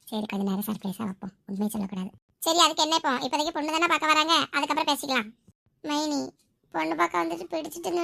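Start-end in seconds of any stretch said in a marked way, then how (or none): 0.1-2.1 low, soft inner thought; secretive aside. Acted. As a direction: murmur it low to yourself. Private thought, keeping a secret. (மனசுக்குள் பேசும் தாழ்ந்த குரல்)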